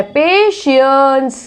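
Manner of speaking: पेशेंस slowly, PAY-shens with sh; drawn-out पेशेंस with sh; careful pronunciation. Shown as a demonstration. Teacher spells out a new word slowly, sh. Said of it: "ऐसी पेशेंस भी एक word".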